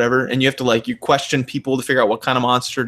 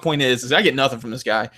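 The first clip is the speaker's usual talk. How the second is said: normal talking voice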